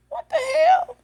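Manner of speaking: exclaiming in disbelief, pitched high; retold disbelief. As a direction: replay your shock, voice jumping up.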